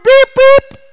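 very high, piercing spoken beep; mimicked bleeped cussing; imitated censor beep. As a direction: cut in with a high-pitched bleep over a swear word. Imitating censored cursing mid-vow.

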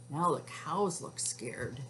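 ordinary delivery

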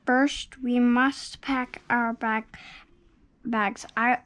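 usual speaking voice

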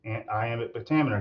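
normal talking voice

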